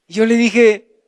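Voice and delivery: usual speaking voice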